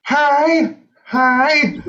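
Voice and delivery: tiny high squeak; squeaky car voice. Pretend. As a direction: squeak it like brakes. Car squeak bit repeated.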